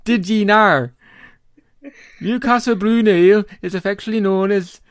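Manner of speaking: loud, high Geordie that drifts Welsh; a hammed-up, failing Geordie accent; mock Geordie accent. Host reads the bottle label aloud in a mock Geordie accent that slips toward Welsh. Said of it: "that's a bit Welsh".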